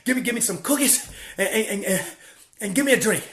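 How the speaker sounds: high, loud, panting order at the counter; out of breath. Retold or imitated. Breathless at McDonald's ordering food for her. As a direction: blurt the order between gasps. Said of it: "I'm out of breath".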